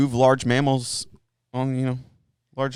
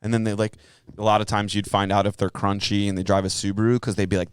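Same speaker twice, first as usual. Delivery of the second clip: ordinary delivery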